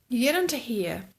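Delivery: usual speaking voice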